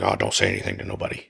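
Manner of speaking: a low, fast, hushed mumble, keeping it secret; lightly voiced humble Tyson, low and quick; imitating Tyson low and quiet. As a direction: mutter it like you don't want credit.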